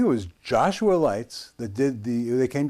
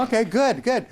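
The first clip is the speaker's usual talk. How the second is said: mock reply; 11 semitones higher than usual. Dylan's reply, retold.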